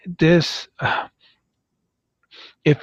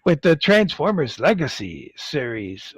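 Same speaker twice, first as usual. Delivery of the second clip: usual speaking voice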